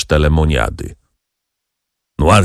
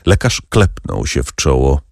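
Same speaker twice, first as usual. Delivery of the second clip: ordinary delivery, nothing marked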